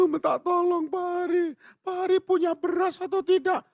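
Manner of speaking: high, thin pleading voice; pleading widow. Retold or imitated. Widow on the phone begging the pastor for rice, high pleading.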